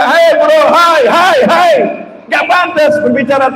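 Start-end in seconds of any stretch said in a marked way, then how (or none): 0.0-1.7 high, repeated shouted greetings; mock yelling. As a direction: mimic people hollering hello in public. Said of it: "bukan yang teriak".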